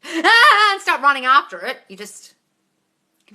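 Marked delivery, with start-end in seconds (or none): 0.0-0.7 high loud chasing shout; mock yell. Scaring the monkey off with a loud high yell. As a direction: shriek like lunging at a monkey.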